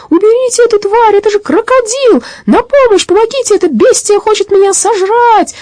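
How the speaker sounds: very high-pitched frantic shrieks; panicked screaming. A narrator's reading. Robber terrified of the police dog, panicked screaming. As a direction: scream for rescue from a beast. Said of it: "Это кричал Хотценплотц".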